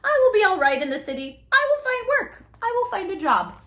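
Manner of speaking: high, loud, quick plucky promise; brave young horse. A narrator's reading. Robert reassuring his parents, high and plucky. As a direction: sound brave and hopeful. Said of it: "he said".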